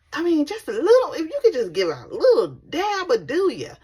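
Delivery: a hint of a chirpy nurse coaxing.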